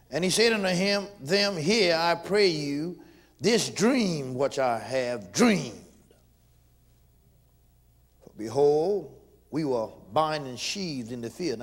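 loud, slow scripture preaching. Acted. Preacher proclaiming Joseph's dream, loud and slow.

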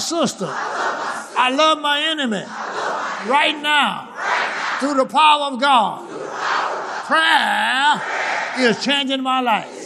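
high, drawn-out preacher's cry; fervent preaching. Real, not acted. Preaches in raised voice.

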